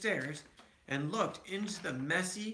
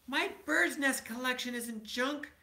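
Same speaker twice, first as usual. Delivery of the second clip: high, raised cub protest; top of his lungs; 10 semitones higher than usual. A narrator's reading. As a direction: yell it to defend his collection. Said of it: "said Brother at the top of their, his lungs".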